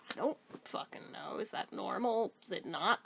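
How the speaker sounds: hushed, breathy aside under the breath; whisper. Real, not acted. Rider baffled by the bus noise, whispering. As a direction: drop to a whisper, half to yourself.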